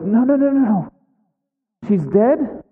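high, rapid string of refusals; acted alarmed denial. Bad news interrupts the celebration, acted.

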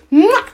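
short, high smack noise; imitated slap. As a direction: mimic the slap with a quick "mwah". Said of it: "I smacked the".

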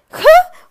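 sudden high loud laugh at herself; burst of laughter. Real, not acted. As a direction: break into a real laugh.

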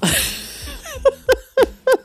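big high laugh; high delighted laugh. Real, not acted. Laughing at Darius's outburst, high and delighted.

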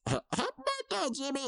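high, fast, chirpy birthday call; cheery high greeting to Jimmy; mock cheery greeting. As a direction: sing it out brightly and fast. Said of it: "leans over and says".

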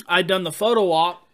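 usual speaking voice

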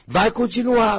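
high sing-song mock warning. To viewers, teasing singsong. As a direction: tease it up high and loud. Mocking that it never changes.

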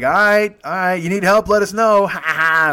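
loud, high call ending in laughter; imitating rowdy, laughing kids; teasing crowd. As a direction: call out a mocking offer.

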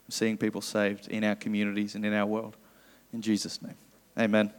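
soft, low prayer voice. Acted. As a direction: pray quietly to Jesus. Leads a prayer.

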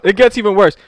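normal talking voice

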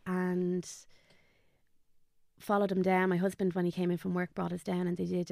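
normal talking voice

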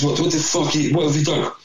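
high, incredulous outburst at a teammate; shocked disbelief. Retold or imitated. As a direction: blurt it out in total disbelief. Shocked at teammate's shaved legs.

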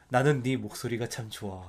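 soft, low gentle tree; tender tree voice. Retold or imitated. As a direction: speak softly like a kind old tree. Tree speaks tenderly to boy.

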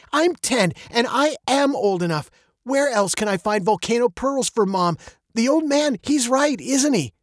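put-on high-pitched, insistent child; pleading girl. A narrator's reading. Veronica pleads with Daddy for the trip, insistent. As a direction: plead your case as a ten-year-old. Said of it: "she said".

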